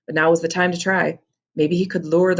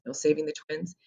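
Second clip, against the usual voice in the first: ordinary delivery, nothing marked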